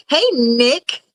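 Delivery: odd drawn-out greeting with rising endings; weird greeting. Real, not acted. Streamer greets Nick arriving in chat, weird drawn-out hello. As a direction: repeat the name oddly, each one lifting. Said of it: "Sorry, that was weird".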